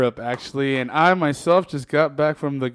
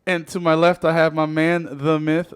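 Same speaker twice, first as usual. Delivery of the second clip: usual speaking voice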